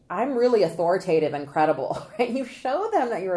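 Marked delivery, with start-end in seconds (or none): 0.1-2.0 loud, emphatic self-declaration; mock authority. Consultant mocking lawyers who announce their credibility, loud mock authority. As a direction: proclaim it with exaggerated authority. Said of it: "you don't have to say".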